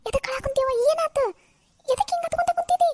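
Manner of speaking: high, anxious demand to know; alarmed questioning. Acted. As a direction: ask in rising panic. Alarmed mother questions weeping Pushpa. (ಗಾಬರಿಯಿಂದ ಕೇಳುವುದು)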